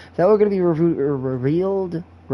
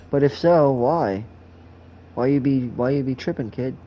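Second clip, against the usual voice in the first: normal talking voice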